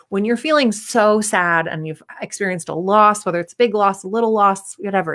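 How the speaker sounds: normal talking voice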